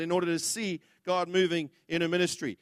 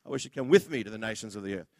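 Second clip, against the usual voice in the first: with given the weight, said fast; stress on with; 10 semitones lower, 4 dB quieter, faster than usual. Shown as a demonstration. Preacher corrects himself to the congregation, stressing with. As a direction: rush it, hitting with. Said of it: "No, that's not what I mean".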